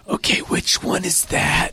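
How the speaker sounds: hushed whisper leaning over; whispered question. Retold or imitated. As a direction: whisper it so only your kids hear. Leaning over to ask in arena.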